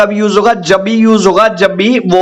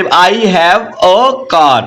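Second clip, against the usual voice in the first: slow dictation; much slower than usual. Shown as a demonstration. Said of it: "teacher dictates English sentence".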